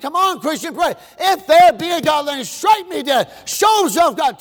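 high, loud taunting shout; shouted dare. Retold or imitated. Preacher voicing Ingersoll challenging God, shouting. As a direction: yell it at the sky.

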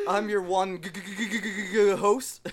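giggling through a stuck g; laughing stutter. Real, not acted. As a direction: stammer it out laughing. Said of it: "I'm fucking stuttering".

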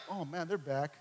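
low, soft, deflated mock reaction; half-hearted, sinking mumble; unenthusiastic grumble. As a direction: imitate someone unhappy to see them. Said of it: "not like".

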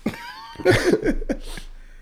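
high rolling laugh that falls away; falling peal of laughter; long laugh. Real, not acted. Laughing with his co-host.

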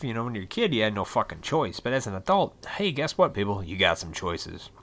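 ordinary talk, nothing marked

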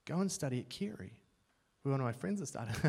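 a quiet prompting voice; small inner voice. Retold or imitated. God's small voice urging him to Carey, softly. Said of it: "this small voice in my head".

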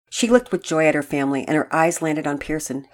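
plain reading voice

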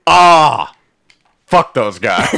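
laughing it off. Retold or imitated. Own reaction, laughing.

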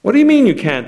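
high, loud indignant demand. A narrator's reading. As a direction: snap it like a spoiled royal. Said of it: "the princess demanded".

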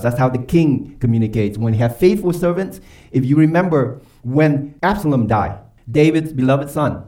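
usual speaking voice

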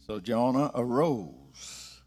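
solemn low scripture reading. Acted. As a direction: read the Bible passage solemnly. Scripture reading opening sermon.

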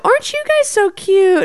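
put-on high baby-talk to the dogs; sugary pet-owner coo. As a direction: coo at them like cute puppies. Humans cooing at dogs.

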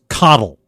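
Cottle said as KOT-ul, falling; corrected name pronunciation. Shown as a demonstration. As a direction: stress the first syllable of Cottle, like coddle. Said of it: "let me help you with that".

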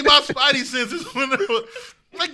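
usual speaking voice

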